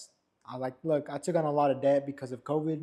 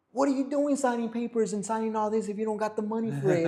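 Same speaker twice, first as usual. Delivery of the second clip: higher, scolding mother's voice; exasperated mom; 8 semitones higher, 3 dB louder than usual. Retold or imitated. Mom scolds son for signing papers without money, exasperated. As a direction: scold him like a fed-up mother.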